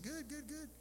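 high and soft quick chirp; light imitation of a young clerk; mimicking the cashier. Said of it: "She said".

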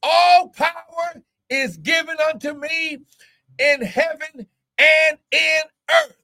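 high, drawn-out preacher's cry; full-force preaching. Real, not acted. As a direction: preach it high, leaning on key words.

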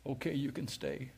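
God's quiet answer. Retold or imitated. Preacher recalling God speaking in his spirit, soft and low. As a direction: lay it down softly like a voice inside. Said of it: "God said this in my spirit".

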